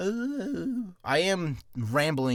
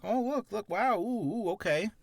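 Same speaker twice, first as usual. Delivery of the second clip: mock wonder; 6 semitones higher than usual. Shown as a demonstration. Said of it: "just pull up an article and be like".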